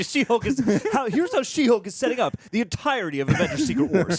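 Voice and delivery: high, loud, hammed-up announcer excitement; mock hype. Co-host parodying fan theories about Secret Wars, mock hype.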